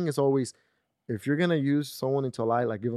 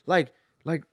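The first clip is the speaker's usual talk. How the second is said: imitated indignant rant, pitched up; retold angry cussing; 7 semitones higher than usual. Friend griping about being put on the spot, mock indignant. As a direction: replay a mad friend's complaint in a higher voice. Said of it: "fucking cussed me out and got mad".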